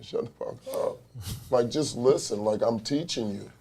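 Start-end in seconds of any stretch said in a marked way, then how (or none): 0.0-1.0 hushed, whispered curse; whispered swearing. Retold or imitated. Coach admitting what he told his player, whispered. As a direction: whisper it like an aside.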